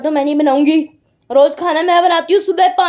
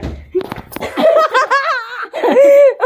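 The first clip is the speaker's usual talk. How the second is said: high burst of real laughter; giggling fit after getting caught; 10 semitones higher than usual. Real, not acted.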